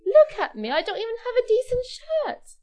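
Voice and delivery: high, loud, exasperated objection; acted flustered dismay; indignant protest. Jack protests he cannot meet queen.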